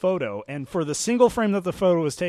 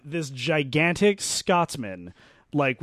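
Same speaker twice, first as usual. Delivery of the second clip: ordinary delivery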